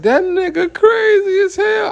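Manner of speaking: shocked women shouting. Retold or imitated. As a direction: yell it like the startled passengers. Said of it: "They like".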